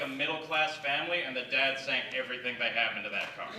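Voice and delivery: normal talking voice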